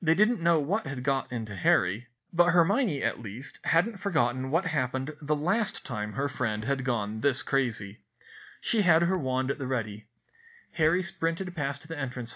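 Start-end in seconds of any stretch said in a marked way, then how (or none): none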